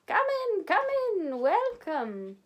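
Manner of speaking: loud cheery welcome. A narrator's reading. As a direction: call out jolly and delighted. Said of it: "called a loud, cheery voice".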